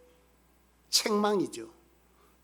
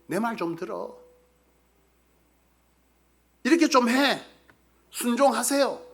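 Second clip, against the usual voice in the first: pressuring command; 5 semitones higher than usual. Retold or imitated. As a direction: press the listener into obeying. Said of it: "강압입니다".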